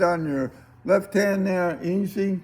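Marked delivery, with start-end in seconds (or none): none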